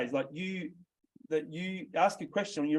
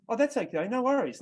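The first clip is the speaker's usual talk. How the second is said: mock salesperson brushing off; 7 semitones higher than usual. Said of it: "You're going".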